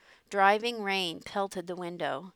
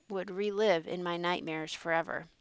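ordinary delivery

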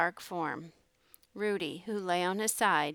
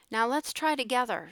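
raised, louder team call; yell; 5 semitones higher, 5 dB louder than usual. A narrator's reading. Sharky yells to Jake from inside the boxcar, yell. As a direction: yell instructions through a door. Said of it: "Sharky yelled".

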